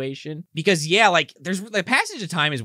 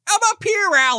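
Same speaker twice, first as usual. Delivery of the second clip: high loud call from far above; Robin calling down; 4 dB louder than usual. Retold or imitated. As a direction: shout down cheerfully. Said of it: "He's like".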